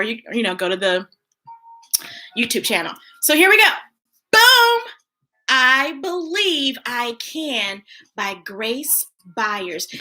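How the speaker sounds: usual speaking voice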